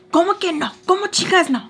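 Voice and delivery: high-pitched mock indignation; mock outrage. Girlfriend mimicking her boyfriend's outrage, high and indignant. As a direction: throw his protest back at him in a high voice.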